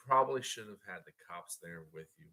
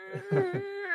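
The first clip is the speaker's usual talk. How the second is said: high squealing laugh; 4 dB louder than usual. Real, not acted. Laughing while retelling.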